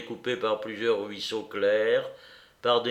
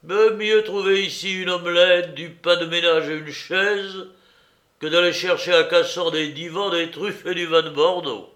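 louder, higher, eager delivery; enthusiastic exclamation; 5 semitones higher, 6 dB louder than usual. A narrator's reading. Weary counsellor glimpsing a house wall, enthusiastic exclamation. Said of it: "Ces paroles étaient une exclamation d'enthousiasme".

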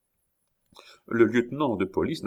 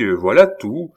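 plain reading voice

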